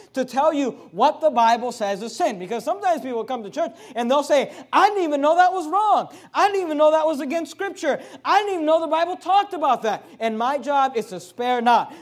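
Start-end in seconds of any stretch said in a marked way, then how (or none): 4.7-10.0 higher, louder amazed voice; surprised churchgoer. Retold or imitated. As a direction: sound shocked you never knew.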